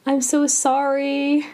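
plaintive reenacted lament; imitated anguished cry. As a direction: retell a father's sorrowful cry, voice held high. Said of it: "the doctor cries out".

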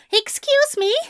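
shrill, affronted. A narrator's reading. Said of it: "the shrillness of his own voice".

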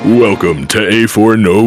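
ordinary talk, nothing marked

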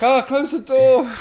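very high, loud, drawn-out cry; comic reenacted shriek; retold panicked yell. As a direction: play the alarmed friend shrieking, slowly stretched. Acted Pablo panicking at door.

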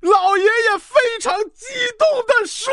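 high, hammed-up impassioned shout; very excited. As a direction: declaim it at the top of your emotion.

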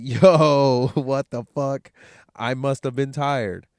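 loud stunned "what the fuck" after oversleeping; surprised outburst. Retold or imitated. Waking from his nap at three, loudly surprised.

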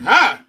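sudden loud yelp of fright, hammed up; mock startled yelp. Spotting a child behind him in the bathroom, mock yelp. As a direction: jump and yelp like you just got spooked.

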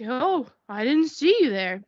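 slow, drawn-out dopey apology; mock oblivious driver. Driver who hit them getting out, mock oblivious. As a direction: lightly play a clueless driver. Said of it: "and was like".

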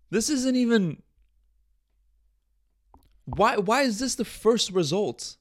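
high, slowed disbelief; baffled. Real, not acted. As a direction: stretch out the disbelief. Reacting to a weird search result.

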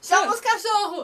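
high-pitched celebrity catchphrase impression; Ana María Braga. Quoting a famous Brazilian to the co-host, high-pitched impression. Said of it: "Ana María Braga".

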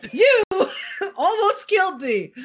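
laughing through the words. Real, not acted. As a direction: blurt it while cracking up. Laughing outburst.

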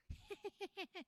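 high eerie doll giggle; evil doll giggle. Acted. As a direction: giggle like a creepy toy. Doll runs off laughing.

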